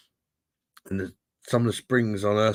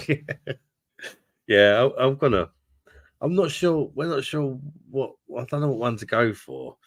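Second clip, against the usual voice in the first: flustered laugh; 7 semitones higher than usual. Real, not acted. Flustered by teasing chat.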